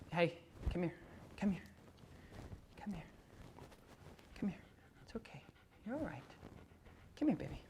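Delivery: mock soft-hearted owner coaxing her dog; pleading coax. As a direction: beg the dog to come, soothing and soft. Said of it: "instead of just".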